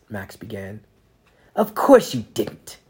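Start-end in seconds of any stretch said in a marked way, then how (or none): 1.6-2.8 slow, louder sarcastic retort; mocking slow jab at a child; sarcastic snap. A narrator's reading. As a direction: throw his excuse back at him. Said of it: "the Inquisitor snapped in sarcasm".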